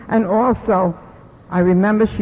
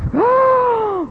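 a sudden high gasp of shock; shocked intake of breath; sharp gasp. Real, not acted. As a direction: gasp as if it knocks the wind out of you. Overwhelmed by a stranger's story.

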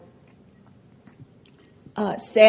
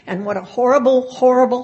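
horrible pushed louder each time; emphatic horrible; 4 semitones higher, 6 dB louder, faster than usual. Real, not acted. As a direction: punch horrible harder on the repeat. Bitter self-reproach.

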